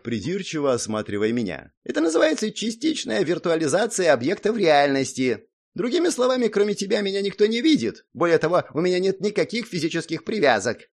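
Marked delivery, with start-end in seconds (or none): 1.8-10.9 high-pitched little gnome explaining; lecturing gnome. A narrator's reading. Gnome explaining his projection to the hero, lecturing.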